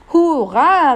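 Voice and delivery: high, loud cheer; delighted shout. A narrator's reading. Jakobus hears the decree, cheering high and loud. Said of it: "rief Jakobus und hüpfte so entzückt".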